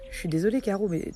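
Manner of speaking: ordinary talk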